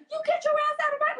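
high-pitched mock telling-off; scolding mom. Daughter imitates pub-owner mom ejecting a man, scolding. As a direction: imitate a fierce mother ordering someone out.

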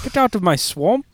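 high-pitched Scottish ogre imitation; mock Scottish accent. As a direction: play a badly faked Scottish swamp ogre. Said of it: "terrible Scottish accent".

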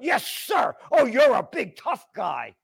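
mock him as a big tough guy; sneering fake respect; sarcastic mockery. Mocking the guard.

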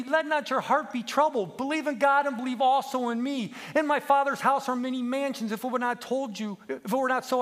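put-on high, childlike homesick plea; tearful pleading. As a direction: plead through tears, slowing at the end. Crying lamb pleads and recalls promise.

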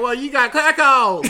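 loud, hammed-up Southern accent; put-on Southern accent. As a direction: lay the Southern accent on thick. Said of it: "I can't help but talk my accent".

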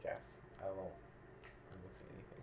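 whispered, giving in; meek whisper. Retold or imitated. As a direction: back down in a hush. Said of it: "other times I'm just like".

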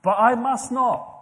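slow, firm and loud; emphatic. Real, not acted. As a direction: put your foot down on each word. Resolving not to favour rich.

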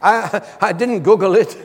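laughing as he denies it; amused, laughing through the words; chuckling while he answers; laughing denial. Real, not acted. Laughs at congregation's Google joke.

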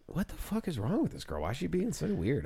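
high, hushed bafflement; baffled complaint. Acted. Imagined customer wondering about the waitress.

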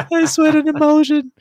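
very high, loud squeal through laughter; squealed repeat. Retold or imitated. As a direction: squeak it out in delight. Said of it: "the best line out of the whole song".

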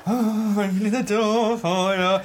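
high, drawn-out show of politeness; obvious politeness. Acted. Door holder showing off a courtesy to a stranger, exaggeratedly obvious. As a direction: make a slow, high performance of the favour. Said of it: "is it really obvious".

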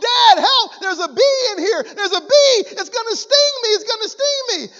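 imitating a frightened boy, high and loud; boy's panicked scream. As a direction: cry out for Dad like a scared child. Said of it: "The little boy begins to scream".

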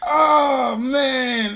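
loud, high wail after cracking up; guilty, tearful moan at the end of a laughing fit; laughing groan. Real, not acted. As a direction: groan it out, almost crying from laughter.

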